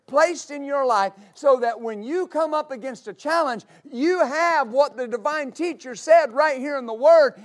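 loud, driving sermon climax; preaching at full force. Real, not acted.

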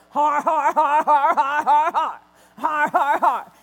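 loud booming har-har laugh, imitated; Hagin's laugh. Preacher imitating an older minister laughing at sickness, loud har-har. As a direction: belt out a defiant har-har laugh. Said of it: "if you hear him tell the story, he goes".

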